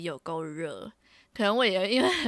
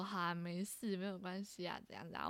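much softer, placating murmur; calm the fight down gently; very quiet reassuring shop clerk; soothing clerk; 14 dB quieter than usual. Retold or imitated. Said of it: "店员都出来劝架".

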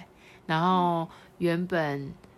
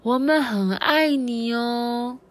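higher, drawn-out storybook call; mock-loud, stretched declaration of love; loud loving call; 7 semitones higher, 6 dB louder, much slower than usual. A narrator's reading. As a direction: call it slowly into grandma's ear. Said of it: "大声地说".

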